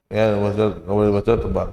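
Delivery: ordinary talk, nothing marked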